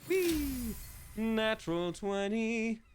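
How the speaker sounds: high drawn-out squeal of delight; slow, high singsong glee; gleeful celebration. Real, not acted. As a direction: stretch it out high, like riding a slide. Celebrating a lucky dice roll.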